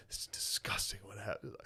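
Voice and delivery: hushed, grave whisper; mock appalled whisper. Comic imitating everybody's reaction, appalled whisper. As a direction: whisper it as if scandalized.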